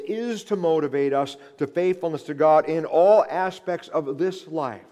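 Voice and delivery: preaching at full force. Acted. Preacher urging the congregation toward faithfulness, full-force preaching. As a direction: drive it home from the pulpit, loud and lifted.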